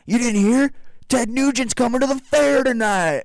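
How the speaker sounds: high, loud hyped-up holler; excited worker. Retold or imitated. Worker breaking big news to a newcomer, thrilled holler. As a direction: crow the big news at full pitch. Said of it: "go up to one of the guys".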